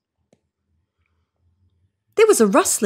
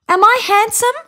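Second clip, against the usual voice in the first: high, louder hopeful call; 5 dB louder than usual. A narrator's reading. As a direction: call out hoping for a yes. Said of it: "called the bunyip".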